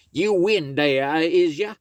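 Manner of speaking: sweet-talking the folks as the fox; high sly fox voice. Acted. Brer Fox greeting the folks from the door, high sly voice.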